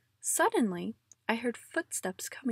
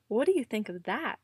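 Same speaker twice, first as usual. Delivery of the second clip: usual speaking voice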